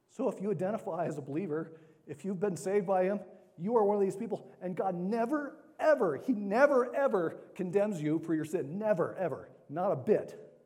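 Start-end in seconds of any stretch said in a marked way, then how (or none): 3.6-10.5 louder, higher insistent never, ever; emphatic preaching. Acted. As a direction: hammer home that God never condemns.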